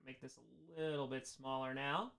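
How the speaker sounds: low, very soft talk to self; quiet aside. Real, not acted. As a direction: say it quietly to yourself. First take, to himself while adjusting.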